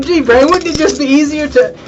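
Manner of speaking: squeaky silly sidekick; Pinky impression.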